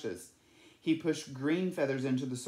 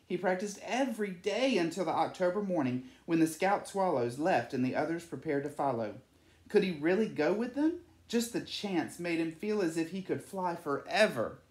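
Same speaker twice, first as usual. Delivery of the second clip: usual speaking voice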